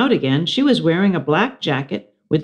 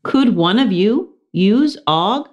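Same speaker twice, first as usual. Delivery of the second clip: high, slow teacher question; teacher asking; 6 semitones higher than usual. Acted. Missus Brisbane asking the class, slow higher voice. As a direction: ask gently and slowly. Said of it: "Missus Brisbane asked".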